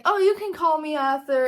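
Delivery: brightly offering his first name; friendly offer. Retold or imitated. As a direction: cheerily insist on first names. Arthur invites first names.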